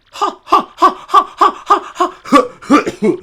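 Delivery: high, loud theatrical ha-ha laugh; hammy noble laugh. Acted. Flavio voiced to the hero, high hammy laughter. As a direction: cackle like a vain aristocrat.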